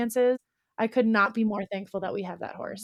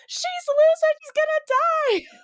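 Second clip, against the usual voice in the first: much higher, louder panicked wail; hammed-up panic that the horse will die; 6 dB louder than usual. Wife wails to husband that loose horse will die, panicked. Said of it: "And I'm like".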